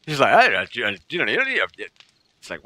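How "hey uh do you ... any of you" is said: fast, low mock-Scottish gabble; unintelligible Scottish clansman. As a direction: rattle it off as an incomprehensible Scot. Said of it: "No idea what he's saying".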